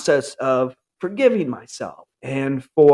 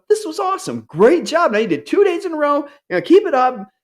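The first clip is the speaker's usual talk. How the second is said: much higher, fast and excited, bursting with pride; enthusiastic self-praise; 11 semitones higher, faster than usual. Shown as a demonstration. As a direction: praise yourself like a proud coach. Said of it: "talking to yourself, going".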